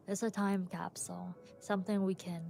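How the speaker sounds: usual speaking voice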